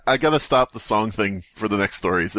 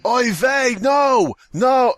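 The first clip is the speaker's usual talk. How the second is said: high, loud panicked refusals; hammed-up horrified denial; mock oy vey panic; 13 semitones higher, 4 dB louder, much slower than usual.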